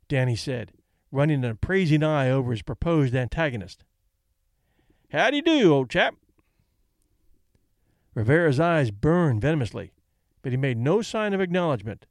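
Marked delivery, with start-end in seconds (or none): 5.1-6.2 mock greeting. Danny mockingly greets his opponent.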